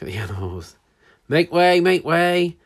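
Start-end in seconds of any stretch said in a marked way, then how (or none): 1.3-2.6 loud call to clear the path; Percy calling loudly from his machine. Acted. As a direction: shout them out of the way.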